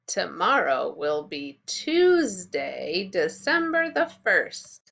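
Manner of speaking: slow, stretched teacher model; slow model date. Shown as a demonstration. Teacher models tomorrow's date slowly for the class. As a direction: draw out "Tuesday" for the kids. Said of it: "let's practice saying our dates".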